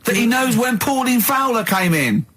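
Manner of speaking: high, incredulous teasing; mock him for what he learned; mocking disbelief. Real, not acted. Mocking Karl's takeaway from programme.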